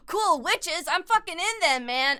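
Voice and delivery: gasping, high and loud delight; real, high excited outburst; thrilled. Real, not acted. Gasp, thrilled reaction to chat.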